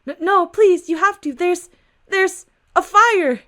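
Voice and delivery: high, loud desperate begging; panicked plea. Acted. Woman begging the officer for help, panicked and high. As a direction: beg for help in a rising panic.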